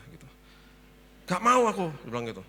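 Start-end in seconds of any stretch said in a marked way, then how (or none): 1.3-1.9 high, loud imitated refusal; emphatic refusal. Wife refusing husband's dog suggestion, emphatic. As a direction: turn it down flat-out, imitating a wife.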